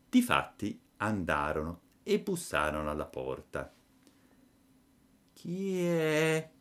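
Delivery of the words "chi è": small, higher voice from inside; tiny voice. A narrator's reading. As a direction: call out small and timid from behind the door. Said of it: "disse una vocina di dentro".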